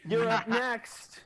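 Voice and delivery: menacing challenge. Acted. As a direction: draw it out as a loud threat. GM voices threat while pointing.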